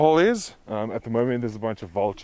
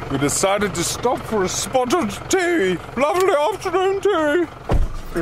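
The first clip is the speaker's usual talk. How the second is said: lightly put-on posh English voice, high; playing the proper English tea-taker; mock posh. To her partner about afternoon tea, lightly mock posh.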